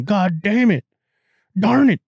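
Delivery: high, loud hammed-up Southern drawl; mock Southern accent. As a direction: play a riled-up Texan. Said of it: "I'd be saying".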